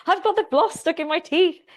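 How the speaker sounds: high, loud cry for help breaking into giggles; laughing retold shout. Wife with floss stuck in her teeth calls Will, laughing shout. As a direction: yell for Will while half laughing. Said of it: "So I was shouting".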